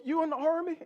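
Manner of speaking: high-pitched, choked-up mock question; mock weepy surprise, pitched high; imitated tearful cop. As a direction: ask it as if about to cry. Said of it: "this guy starts crying".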